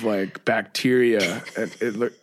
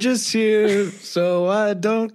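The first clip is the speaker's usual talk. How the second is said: higher, slow mock drone; deadpan bored singer; 10 semitones higher, much slower than usual. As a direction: drag it out like a bored paid performer. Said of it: "He's like".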